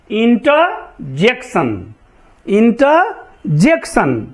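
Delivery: इंटरजेक्शन very slow, inter as inta; slow careful pronunciation. Shown as a demonstration. Teacher models last word slowly for repetition. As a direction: stretch out इंटरजेक्शन slowly, r dropped. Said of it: "अब ईआर से अ देखो".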